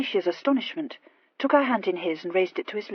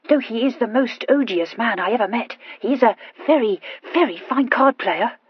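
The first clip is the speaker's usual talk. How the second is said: put-on higher, louder, emphatic girlish confession; Horatia, grudgingly admiring; 4 semitones higher, 7 dB louder than usual. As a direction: lean hard on the repeated praise. Horatia on her opponent.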